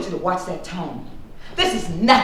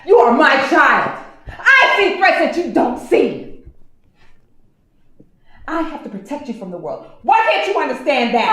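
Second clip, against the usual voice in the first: loud, higher furious scolding; angry yelling; 6 semitones higher, 4 dB louder than usual. Acted. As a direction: yell it at your grown child. Mother yells protectively.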